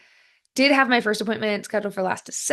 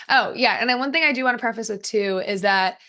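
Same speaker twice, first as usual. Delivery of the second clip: ordinary talk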